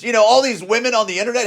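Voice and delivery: ordinary delivery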